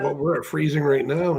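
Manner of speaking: usual speaking voice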